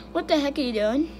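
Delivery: ordinary delivery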